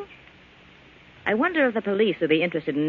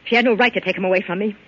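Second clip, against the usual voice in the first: building louder to a bitter outburst; rising resentment; 4 semitones higher, 5 dB louder than usual. Acted. Gloria to Joe about the other woman, rising anger. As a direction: climb until it bursts out. Gloria rages over lost Fred.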